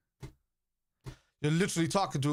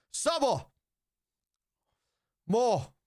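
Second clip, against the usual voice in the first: excited shout; 10 semitones higher, much slower than usual. Real, not acted. Watching the match, calling out.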